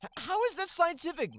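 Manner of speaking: high, loud stammered disbelief; shocked. Acted. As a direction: blurt it out in shock. Shocked at pocketing evidence.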